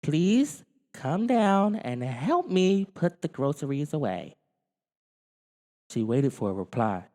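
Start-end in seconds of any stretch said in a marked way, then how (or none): 0.0-4.3 put-on higher, slow girl's voice; Kate calling. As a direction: ask lightly upstairs.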